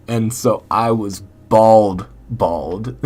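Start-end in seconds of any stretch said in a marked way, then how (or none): none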